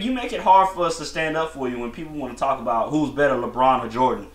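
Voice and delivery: ordinary talk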